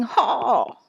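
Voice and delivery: storybook holler; drawn-out yell. A narrator's reading. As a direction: keep calling as you tumble.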